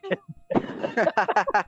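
high-pitched rapid cackle; amused laugh. Real, not acted. Priest amused at fooling the kids twice, high laugh. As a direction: burst into high giggles.

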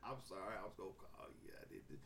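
very soft, low, fading to a whisper; sheepish to mama; meek mumbled apology. Retold or imitated. Son's sorry mumble.

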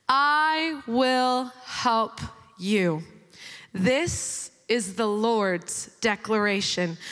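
slow, low, weighty reading of God's words; solemn scripture reading. Acted. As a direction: read each word slowly like a proclamation. Reads scripture aloud.

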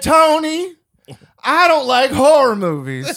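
high, loud, slow whine with a laugh; loud complaint. Retold or imitated. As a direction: protest loudly, laughing. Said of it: "And I said".

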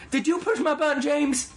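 very high mock interrogation; imitated accusing clerk. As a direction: squeal the accusation like an irate cashier. Acting out clerk accusing a coworker.

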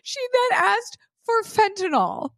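very high voice, close to cracking up; squealing in disbelief. Real, not acted. Telling a friend what the porch lady asked, high giggly squeal. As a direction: squeal it out as if barely holding together. Cracking up at her own story.

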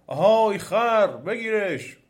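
loud, high, drawn-out order; demon calling out. A narrator's reading. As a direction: holler the command from afar. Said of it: "باز دیو صدا زد".

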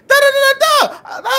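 loud, high yelled filler syllables; mock angry gibberish. Mom's ranting replaced with mock yelled gibberish. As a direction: rant nonsense like an angry mom. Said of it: "my mom said".

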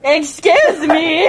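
loud, high, drawn-out exclamation; mock indignation. Rider objecting at being handed the reins, mock indignant. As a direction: cry it out in playful outrage.

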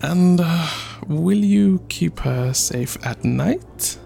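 nervous questioning. Acted. Chief warily questioning a fearsome suitor, nervously. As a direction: tiptoe into the question, voice lifting.

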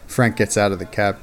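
ordinary delivery

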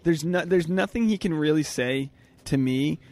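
normal talking voice

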